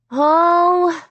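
high, drawn-out "oh" of alarm; startled cry. A narrator's reading. Girl seeing the closet door open, startled cry. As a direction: let out a high cry of dismay. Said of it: "I let out a low cry".

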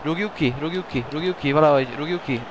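high, urgent pleading shouts; frantic clear it away; excited hungarian sports commentary. Real, not acted. Player reacting to near goal.

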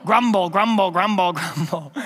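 mock grumbling, trailing downward; grumbling crowd. Preacher imitating the grumbling Israelites, mock grumbling.

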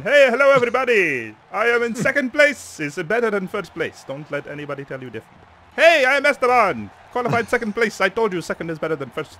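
loud, cheerful mock bravado; happy Esteban. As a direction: greet the crowd like a proud runner-up. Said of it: "He's happy".

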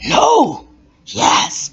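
high startled gasp. Acted. Listener startled by the story, high gasp. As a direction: react in shock.

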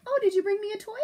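talking to dog. Real, not acted. Teacher greeting her dog with a toy, high pet-talk. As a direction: coo at the dog. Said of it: "It's the dog here".

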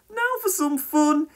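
put-on high, drawn-out mischievous boy voice; giggling mischief. A narrator's reading. Boy with a water pistol spots Trevor, giggly mischief. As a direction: relish the prank in a high, slow voice. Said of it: "he giggled".

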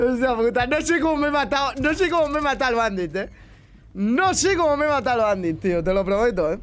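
high, loud amazed exclamation; can't believe what just happened; excited disbelief. Real, not acted. Unexpected kill, excited disbelief. (incredulidad emocionada)

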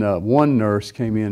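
ordinary talk

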